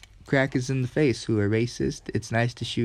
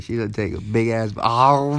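ordinary talk

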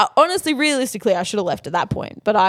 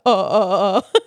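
mock whimpering boyfriend; slower than usual. Boyfriend caught out, retold.

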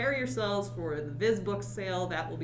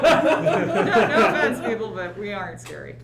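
laughing; 11 dB louder than usual. Real, not acted. Laughing at being called scary.

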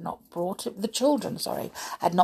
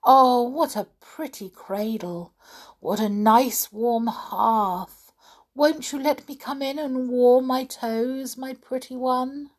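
higher, coaxing goblin voice, slow in places; a sly hobgoblin flattering the cat, higher and coaxing; wheedling hobgoblin; 6 semitones higher, slower than usual. A narrator's reading. Hobgoblin voice held.

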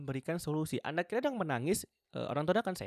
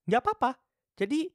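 high reassurance; 11 semitones higher, 7 dB louder than usual. Real, not acted. Reassuring viewers it's okay.

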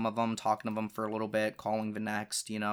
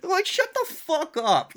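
angry outburst; 6 dB louder, slower than usual. Retold or imitated. Furious at girlfriend retelling fight.

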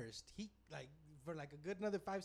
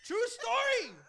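much higher, loud laughing shout; shouted true story; 11 dB louder, slower than usual. Real, not acted. Insisting to his friend the story is real, a loud shout. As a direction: shout it as a vow. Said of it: "shouting it over laughter".

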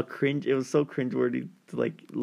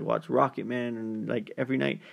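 usual speaking voice

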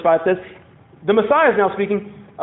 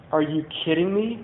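softer, derisive disbelief; incredulous scoff; 3 semitones lower, 6 dB quieter than usual. Acted. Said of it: "It's not a comical, joyful laugh".

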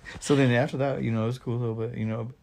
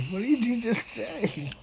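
high, drawn-out retold bafflement; baffled reaction; 12 semitones higher, slower than usual. Reacting to a mumbling buddy, baffled. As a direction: act out not understanding a word, pitched way up. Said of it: "You should hear my buddy Jerry".

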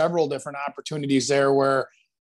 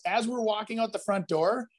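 usual speaking voice

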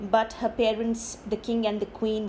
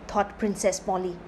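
ordinary delivery, nothing marked

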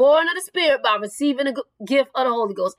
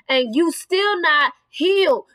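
ordinary delivery

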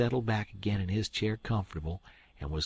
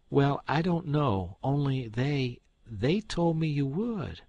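higher, slow hesitant excuse; feigned innocence; 6 semitones higher, 4 dB louder, slower than usual. Acted. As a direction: stammer out the excuse.

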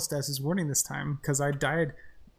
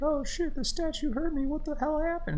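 much higher mock panic; mock bewildered; 12 semitones higher than usual. Mocking own confusion at game, high squeal. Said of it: "be like".

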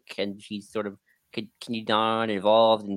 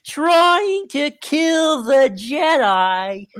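very slow, much higher villain drawl; Marchion impression; 6 dB louder, much slower than usual. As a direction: stretch it out like a menacing villain. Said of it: "my Marchion impression".

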